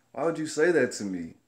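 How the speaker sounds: firm, voiced-up challenge; indignant retold protest. Worker challenging the manager's joke, retold indignant protest. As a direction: demand an answer, put out.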